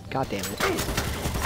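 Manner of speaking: ordinary delivery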